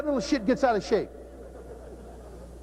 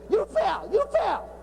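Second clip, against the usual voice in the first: put-on taunting kid; 8 semitones higher, slower than usual. Kids taunting a fallen player.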